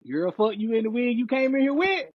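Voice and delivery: high, heated brush-off; indignant dismissal. Retold or imitated. Man rejecting partner's request to talk, high and indignant.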